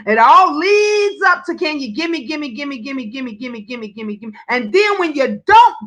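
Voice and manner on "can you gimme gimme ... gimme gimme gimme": rapid-fire gimme, trailing softer; mock begging. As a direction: beg in a rattled-off run, fading away. Acting out addicts begging for money.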